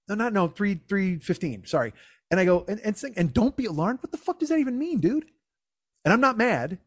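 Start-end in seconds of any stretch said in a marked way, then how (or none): 2.7-5.3 high-pitched, exasperated disbelief; annoyed comic retelling, pitched up. Retold or imitated. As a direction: challenge a friend, voice climbing sharply. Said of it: "but I'm annoyed".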